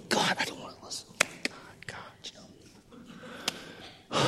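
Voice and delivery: hushed, desperate whispered prayer. Retold or imitated. Man praying alone against temptation, whispered. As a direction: whisper it like a secret plea. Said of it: "And I'm praying".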